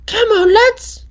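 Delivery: high, squeaky hammed-up British accent; mock British accent. Trying out British on the lads, mock British accent. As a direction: put on a shrill-pitched British lad voice. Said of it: "How's the British going".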